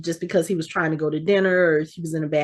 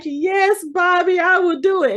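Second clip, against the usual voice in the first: much higher, giddy yes; eager promise; 10 semitones higher, 3 dB louder than usual. Retold or imitated. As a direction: promise like a thrilled fan. Daughter eagerly promises Bobby.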